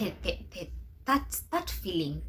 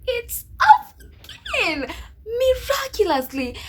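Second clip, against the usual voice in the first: very high, slow, laughing delivery; joyful, tearful news; 7 dB louder, slower than usual. Retold or imitated. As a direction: savor the good news with laughter. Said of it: "And I'm like".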